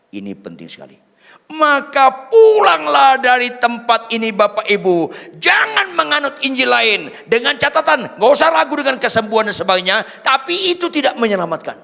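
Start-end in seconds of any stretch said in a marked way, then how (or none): 1.5-4.3 "pulanglah dari tempat ini" shouted high and loud; full-force preaching. Acted. 4.3-11.8 high, loud preacher's call; a pastor thundering at the crowd; full-force preaching. Acted.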